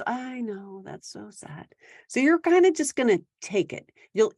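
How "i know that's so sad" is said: quiet, unbothered agreement; calm soft agreement. Shown as a demonstration. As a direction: let it slide softly. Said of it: "I want you to just say".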